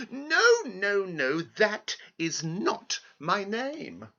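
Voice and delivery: sharp high no, then slow taunting; gleeful little man. Acted. Little man taunting the queen, high slow gloating. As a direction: crow your refusal, high and slow.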